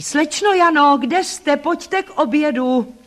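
high, loud call across the garden. A narrator's reading. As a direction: call her in for lunch from afar. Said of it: "hlasité volání".

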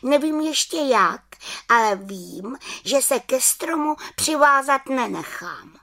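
high-pitched little witch voice; high, resolute little witch. Acted. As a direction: speak up high as a small witch. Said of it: "řekla malá čarodějnice".

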